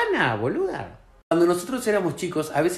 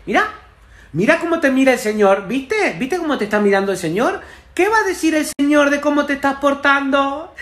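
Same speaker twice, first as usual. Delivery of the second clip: louder, higher pointed scolding; scolding mother; 6 semitones higher, 5 dB louder than usual. Retold or imitated. Mother shaming her misbehaving son in front of a stranger. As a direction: use the stranger to shame the child. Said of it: "le dice".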